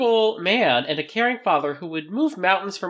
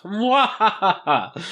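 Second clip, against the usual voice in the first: drawn-out villainous cackle; higher-pitched cartoon villain cackle; mock evil laugh; 4 semitones higher, much slower than usual. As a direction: gloat with a slow, hammy villain laugh. Mock villain gloating over taken Pokemon.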